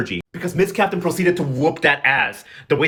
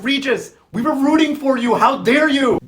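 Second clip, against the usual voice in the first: high, raised mock outrage; outraged scolding; 8 semitones higher, 4 dB louder than usual. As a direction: scold like a betrayed fan. Let-down fan scolds Regis.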